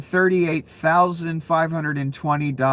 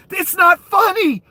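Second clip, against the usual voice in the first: ordinary delivery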